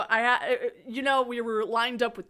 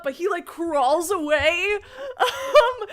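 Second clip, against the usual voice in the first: ordinary delivery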